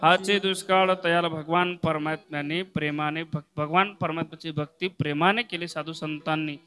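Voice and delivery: usual speaking voice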